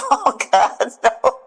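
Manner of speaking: high, laughing "oh no"; laughing dismay. Retold or imitated. Limping woman retelling her reaction to waving soldiers, laughing dismay. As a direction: exclaim it through a laugh, pitch jumping up.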